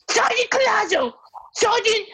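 very high, squeaky imitation of an angry little sergeant; hammed-up high yelling NCO; mock shrill sergeant. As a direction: squeal his rank like a cartoon. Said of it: "he's like".

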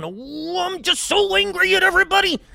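high-pitched mock fuming; hammed-up anger. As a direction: caricature of a man mad at everyone. Absurd angry man acted out.

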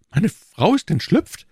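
high, loud astonished question; startled disbelief. Acted. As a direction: exclaim it in shock. Prisoner hears his wife escaped.

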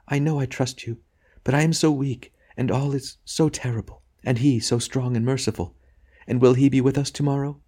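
lightly voiced wife, weak and tearful. A narrator's reading. Wife confessing her trust to her husband through tears. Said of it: "with the flood of tears".

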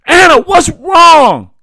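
high, loud, stretched-out begging shout; desperate begging. A narrator's reading. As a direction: beg her at the top of your voice. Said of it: "Daddy begged again".

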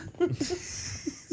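airy laugh up high; high breathy laugh. Real, not acted. Laughing at his own joke, high airy laugh. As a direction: let a soft high laugh escape. Laugh inside patois bit.

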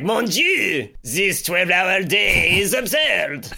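hammed-up French accent, high and loud; mock French accent. Co-host mocks the French clock in a French accent. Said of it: "the more ridiculous accents you can do".

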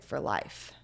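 low and soft, dropping away; quietly retold admission. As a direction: imitate her hushed, grave answer. Retold inmate reveals life sentence.

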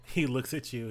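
ordinary delivery

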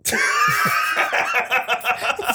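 high shrieking laugh; bursting out laughing. Real, not acted. Hard laugh at insult.

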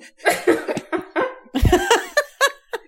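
loud high-pitched laughter. Real, not acted. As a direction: burst out laughing hard. Listener cracks up at joke.

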